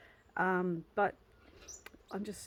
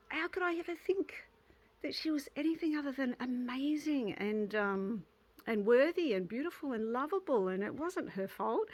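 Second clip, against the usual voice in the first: tender rush; 5 semitones higher than usual. Retold or imitated. Tender reaction to the child.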